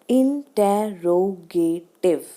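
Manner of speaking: Interrogative slowly in five separate beats; syllable by syllable. Shown as a demonstration. Teacher showing five syllables, slow and separated. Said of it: "यहाँ पे पांच syllables हैं".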